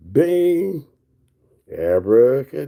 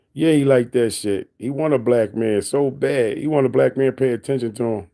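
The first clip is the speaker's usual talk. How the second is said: ordinary talk, nothing marked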